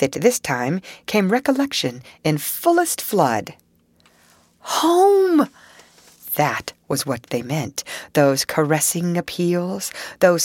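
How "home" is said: a high, loud cry of realization; sudden recognition. A narrator's reading. Mole realizing the smell is home, high sudden cry. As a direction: burst out as it all comes flooding back.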